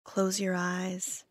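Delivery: calm, measured meditation voice with long pauses; guided meditation. Acted. Therapist leading listeners through loving kindness, calm guided meditation. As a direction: guide them gently, leaving space after each phrase.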